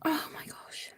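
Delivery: whispered, choked-up oh my gosh; a real awed whisper, no voice; breathless whisper. Real, not acted. Overcome by a friend's gift, a real whisper.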